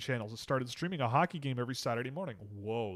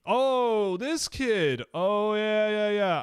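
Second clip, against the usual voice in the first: sudden recognition; 8 semitones higher, 5 dB louder, much slower than usual. Real, not acted.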